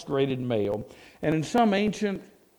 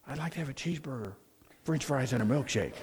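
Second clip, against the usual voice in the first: very soft, hushed order; barely audible, meant for a quiet library; hushed library whisper; 8 dB quieter, faster than usual. Acted. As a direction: repeat the order in a tiny hushed voice. Same order repeated after being shushed.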